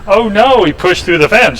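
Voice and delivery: high, sudden alarmed cry of oh no; wife yelling. Retold or imitated. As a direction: call out in alarm as the dog breaks through. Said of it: "my wife yells".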